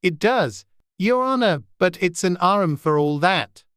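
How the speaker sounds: lightly voiced Irish servant; Irish accent. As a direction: Pat answering the rabbit in mild Irish. Pat voiced by reader.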